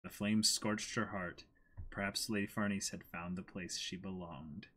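quiet, even reading of the page; reading aloud. Acted. Reading the page aloud to co-host, quiet narration. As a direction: read the narration softly to a friend.

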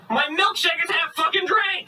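high-pitched furious disbelief; outraged. Acted. Customer finds his milkshake half drunk, outraged. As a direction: explode at the discovery.

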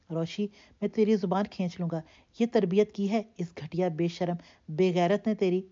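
plain reading voice

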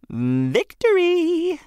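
high, slow, stretched cry of victory; triumphant shout. A narrator's reading. Benny opens box triumphantly.

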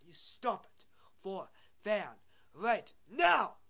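loud shouted order. Pretend. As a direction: shout the last command.

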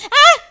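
short, high, loud yelp; sudden loud shriek of surprise; startled scream. Real, not acted. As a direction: cry out as if caught. Startled by the game.

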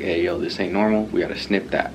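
ordinary delivery

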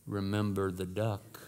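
low, slow, soft sly threat; sister's hushed reminder to brother; whispered warning. Retold or imitated. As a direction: murmur it like a secret blackmail. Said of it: "again she whispered".

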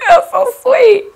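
high, half laughing, half crying; moved by fans' love, laughing through tears; laughing, near tears. Real, not acted. Emotional reaction to fans.

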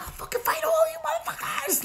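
high laughing yell of a threat; flipping out. Retold or imitated. Woman wilding out at soldiers, laughing shriek. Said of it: "I start flipping".

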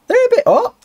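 high, loud squeal at the heat; squeaky yelp. Real, not acted. Player grabbing hot potatoes, a squeaky yelp.

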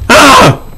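sudden high, loud frightened scream; real scream. Real, not acted. Jump scare in horror game.

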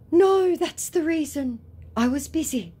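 protesting scammer. Retold or imitated. Scammer denying he is a fake, mock protest. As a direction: protest in a high put-on voice.

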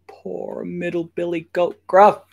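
high-pitched storyteller's sympathy; high, worried aside to children; mock pity. Storyteller pitying the goat in danger.

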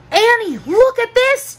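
high, excited call to Annie; high-pitched calling voice. A narrator's reading. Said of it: "Jack called".

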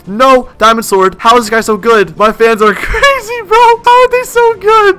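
high-pitched, rising outburst with a laugh; frantic, laughing exasperation; exasperated disbelief. Real, not acted. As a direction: squeal in helpless disbelief. Overwhelmed by skilled fans.

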